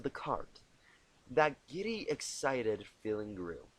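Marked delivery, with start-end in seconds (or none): none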